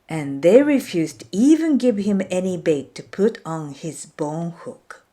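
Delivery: plain reading voice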